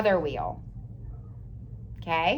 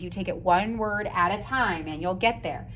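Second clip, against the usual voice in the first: ordinary talk, nothing marked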